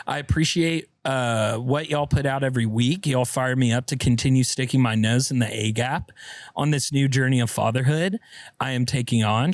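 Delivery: usual speaking voice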